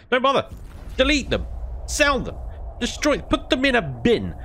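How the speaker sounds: over-the-top gamer rant at the devs. Real, not acted. Rant at boss fight and devs.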